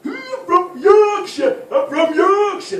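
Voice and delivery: very high, drawn-out Yorkshire bellow; hammed-up slow Yorkshire shout; painfully Yorkshire man. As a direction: roar it like a proud Yorkshireman, stretching the words. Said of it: "They're like, painfully York".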